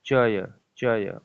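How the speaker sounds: चय, चय drawn out slowly to isolate the अय sound; sounding out the syllable. Shown as a demonstration. Teacher isolating the अय sound in चयन for students, slowly. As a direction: stretch चय, चय slowly so the अय is heard. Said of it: "अय आया".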